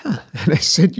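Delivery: ordinary delivery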